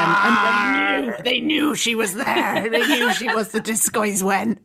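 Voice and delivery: slow, laughing mock Cornish accent; teasing friend imitating Jo's Cornish accent, laughing. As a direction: drawl it out in hammed-up Cornish. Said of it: "dropped into her Cornish accent".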